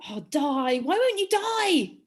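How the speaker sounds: loud theatrical plea climbing high; hammed-up frustration at an unkillable plant; mock exasperated cry. Quizmaster acting out the riddle again, mock exasperation.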